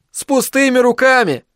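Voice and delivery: high-pitched indignant grumble; grumbled protest, pitched well up; grumpy retort. A narrator's reading. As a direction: throw it back in a jump of pitch, disgruntled. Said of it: "буркнул Айзек".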